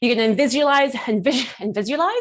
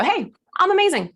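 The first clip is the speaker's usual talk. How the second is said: high, showy self-introduction; confident entrance; 7 semitones higher than usual. Acted. Coach acting someone walking in to a date, confident. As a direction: announce yourself with mock-diva pride. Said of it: "you go".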